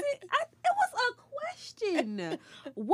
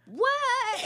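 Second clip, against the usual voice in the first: shocked mom; 9 semitones higher than usual. Retold or imitated. Mom shocked at daughter's story.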